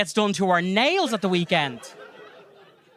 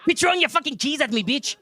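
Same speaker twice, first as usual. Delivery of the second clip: high-pitched mock Mexican accent; 6 semitones higher than usual. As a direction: put on a cartoonish Mexican accent. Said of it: "you can do the accent".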